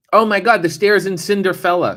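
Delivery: high, loud burst of delight; excited realization. Real, not acted. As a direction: blurt it out as the answer suddenly hits you. Suddenly remembers a favorite staircase.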